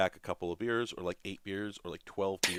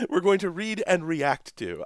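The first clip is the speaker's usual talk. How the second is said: much higher, louder, slow hammed-up intro; enthusiastic; 10 semitones higher, 8 dB louder, slower than usual. Co-host mimicking a read-and-react show intro, enthusiastic. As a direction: stretch it out in mock-enthusiastic host style.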